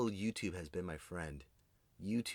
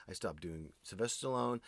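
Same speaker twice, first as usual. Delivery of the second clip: ordinary delivery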